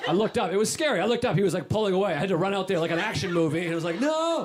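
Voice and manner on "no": high cry, half laughing; hammed-up desperate no; mock cry of no. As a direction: yell it like an action movie. Running after dad's car.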